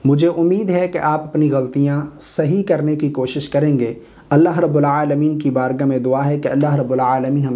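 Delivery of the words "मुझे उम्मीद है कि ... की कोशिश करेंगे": normal talking voice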